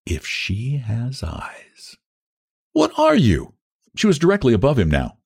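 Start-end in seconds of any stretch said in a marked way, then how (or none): 0.1-2.0 very slow, hushed repeat; soft, drawn-out murmur; under his breath. A narrator's reading. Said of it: "repeated it under his breath". 2.7-3.5 put-on very high, girlish question; curious, high-pitched young woman; girl's question. A narrator's reading. Girl calls down to the man.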